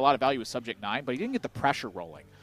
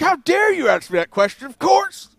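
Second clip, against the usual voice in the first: high, loud squeaky indignant voice; hammed-up huffy imitation of him; mock outrage; 12 dB louder than usual. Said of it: "He's like".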